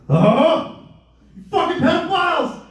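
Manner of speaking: high, loud outraged yell; furious yell. Acted. Comic at the locked store door, high furious yell.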